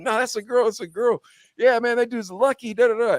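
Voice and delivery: high-pitched retold arguing, not truly shouted; mock screaming. Retelling the shop argument, mock screaming. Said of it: "We're screaming".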